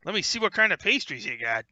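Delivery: lightly voiced gnome with a cigar; cigar-chomping gnome. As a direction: chew the words like there's a cigar in your mouth. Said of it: "gnome comes over chomping on his cigar".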